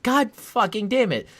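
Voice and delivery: high, loud frustrated curse; exasperated curse. Retold or imitated. Locked out again, a loud high frustrated curse. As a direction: blurt it out in exasperation.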